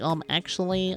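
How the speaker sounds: mock a pedantic correction; nitpicking commenter. Viewer in the comments correcting the reviewer, nitpicking. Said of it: "someone in the comments".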